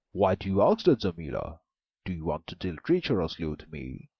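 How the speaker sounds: loud, suspicious ogre voice; the ogre suspects a trick; an ogre questions the maiden, loud and suspicious. A narrator's reading. Ogre's voice.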